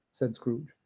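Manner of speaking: usual speaking voice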